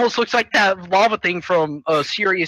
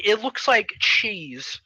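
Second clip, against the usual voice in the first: usual speaking voice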